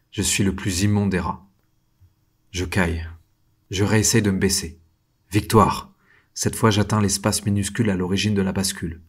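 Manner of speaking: ordinary delivery, nothing marked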